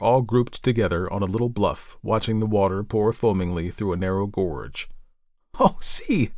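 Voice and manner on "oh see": high, delighted exclamation; high-pitched eager call; excited cry. A narrator's reading. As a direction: cry out on spotting something. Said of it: "cried Elsie".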